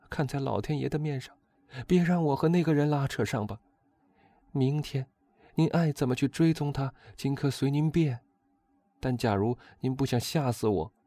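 high, urgent woman's voice; pleading, anxious countess. A narrator's reading.

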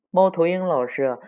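ordinary delivery

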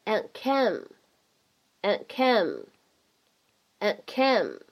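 and came with the d unreleased; 失去爆破; lost plosion. Shown as a demonstration. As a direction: drop the d burst in and came. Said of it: "失去了爆破".

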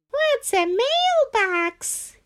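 put-on high, slow puzzled kid. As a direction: ask like a clueless kid.

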